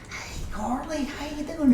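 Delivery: high, sing-song flirty hello; flirty greeting. Retold or imitated. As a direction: greet her like a smooth flirt. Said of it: "one of those ones that's like".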